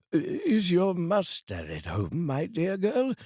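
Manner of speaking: high, slow and sweetly coaxing; jovial old man. Acted. Scrooge sweetly asking the maid for his nephew. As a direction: charm the maid with exaggerated kindness.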